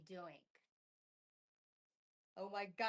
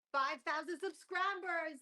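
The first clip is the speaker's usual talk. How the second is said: louder, slower, higher milestone call; triumphant milestone shout-out; proud announcement; 6 semitones higher, 7 dB louder, slower than usual. Real, not acted. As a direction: announce it like a big reveal.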